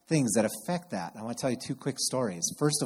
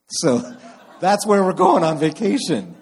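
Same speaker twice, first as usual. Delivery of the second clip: laughing; 6 semitones higher, 10 dB louder than usual. Real, not acted. Ironic vacation plan to fire zone.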